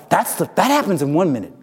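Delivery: normal talking voice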